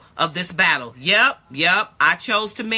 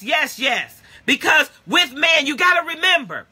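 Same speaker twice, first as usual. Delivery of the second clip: higher, louder, driving sermon; full-force preaching; 5 semitones higher, 3 dB louder than usual. Acted. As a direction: preach it hard to the church.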